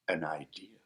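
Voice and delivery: low breathy whisper of a secret. A narrator's reading.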